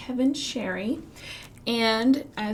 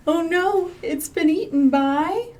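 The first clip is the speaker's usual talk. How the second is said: mock alarm; 7 semitones higher, 7 dB louder than usual. Fish gets eaten.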